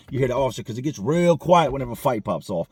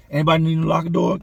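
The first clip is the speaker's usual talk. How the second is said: loud, higher call to the room; 6 semitones higher, 7 dB louder than usual. Retold or imitated. Maintenance worker calling out to the inmates, loud offer. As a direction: holler the offer across the dorm.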